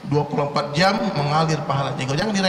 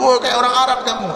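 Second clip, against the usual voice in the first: high, louder mock taunt with a laugh; mocking jibe; 6 semitones higher, 4 dB louder than usual. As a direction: tease him like a scoffing neighbour. Mocking someone who wears sunnah.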